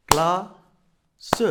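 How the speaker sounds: "Classe" as cla, long pause, seu; cla... se for "Classe", clapping-drill style; tapped-out syllables. Shown as a demonstration. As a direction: beat out "Classe" in two syllables, voicing the final e. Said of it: "tapez le mot classe".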